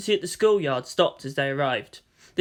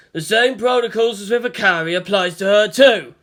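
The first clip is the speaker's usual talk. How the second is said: Toji shouting; 4 semitones higher, 7 dB louder than usual. A narrator's reading. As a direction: stop your friend from firing. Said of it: "Suzuhara shouted as well".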